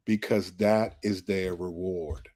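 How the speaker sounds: low, slow voice of the Lord; still small voice. Retold or imitated. The Lord answering an unasked question, still small voice. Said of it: "the still small voice of the Lord".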